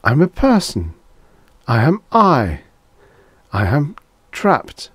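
high, desperate insistence; halting, anguished disembodied voice; pleading inner voice. A narrator's reading.

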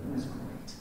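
soft, dry sarcastic murmur. Real, not acted. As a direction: say it with heavy sarcasm, barely voiced. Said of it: "You can tell my sarcasm there".

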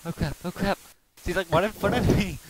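high loud panicked cry; being chased. Real, not acted. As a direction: panic as it appears ahead. Monster appears in front.

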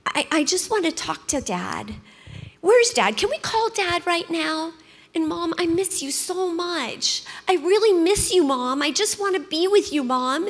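put-on a small child asking for Dad and Mom; imitating a little girl. Daughter begging grandparents for her parents, a pleading little-girl voice. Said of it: "she was saying things like".

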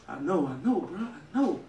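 calm him down gently; soft reassurance. Acted. Soothing Bush, softly.

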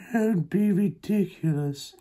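high, slow cat voice. Acted. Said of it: "said the cat".

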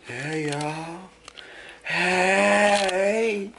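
squeaky hello to viewers; high cheery greeting. Real, not acted.

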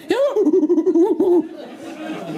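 high, excited run of hoots; monkey hooting. Acted. Monkey confirming the sergeant's guess, high hooting. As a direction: hoot back like a monkey confirming it. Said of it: "The monkey says".

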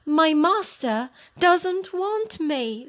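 a hint of an unwanted old dog; rejected old dog. A narrator's reading. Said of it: "says the dog".

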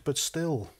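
normal talking voice

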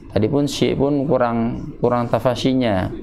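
normal talking voice

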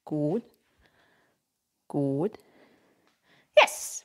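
ordinary talk, nothing marked

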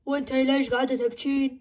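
low, eerie mother's voice; a stranger speaking through mom. Acted. Possessed mother asking who is crying, low and eerie.

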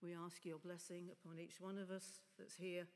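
ordinary delivery

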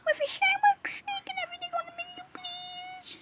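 very high, thin put-on voice; high-pitched pretend customer; squeaky customer voice. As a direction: order in a tiny squeak.

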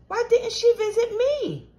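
high, sustained plaintive question; plaintive complaint. A narrator's reading. Girl upset the fairy visited her brother, high plaintive complaint. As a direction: wail it lightly, pitched right up.

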